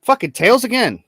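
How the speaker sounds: loud, high exasperated curse; frustrated outburst. Real, not acted. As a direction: blurt it out, fed up. Coin lands tails again.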